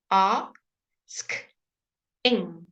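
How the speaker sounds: "Ask" then "ing" as two separate chunks; split into parts. Shown as a demonstration. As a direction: break "asking" into "Ask" and "ing" with a pause. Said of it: "The emphasis is on a".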